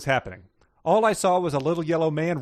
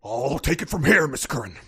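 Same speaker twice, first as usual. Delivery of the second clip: loosen the jowls and play Nixon; Richard Nixon impression; 3 dB louder than usual. Shown as a demonstration. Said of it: "let's hear it".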